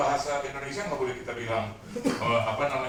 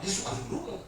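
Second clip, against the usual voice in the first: ordinary talk, nothing marked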